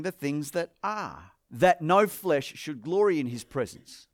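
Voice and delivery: solemn reading of Corinthians to the congregation; scripture reading. Acted. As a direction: read the passage with weight. Reads scripture aloud.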